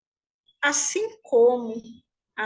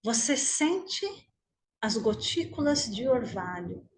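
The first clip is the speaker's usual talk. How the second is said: guided meditation; 3 dB quieter than usual. Acted. Teacher leading the group lying on imagined grass, guided meditation. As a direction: guide them gently inward, unhurried, leaving room to breathe.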